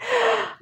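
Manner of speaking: sharp high gasp; a quick startled intake of breath; mock gasp. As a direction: catch your breath in mock alarm. Acting her reaction mid-story.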